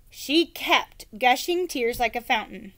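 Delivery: ordinary delivery, nothing marked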